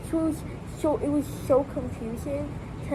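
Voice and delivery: ordinary talk, nothing marked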